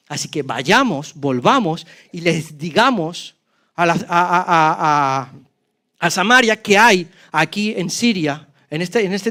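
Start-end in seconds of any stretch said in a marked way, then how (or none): none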